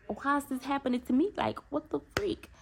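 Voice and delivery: retold exasperation, voice lifted high; retold mad complaint. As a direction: act out a mad "why me" protest. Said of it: "I was so mad".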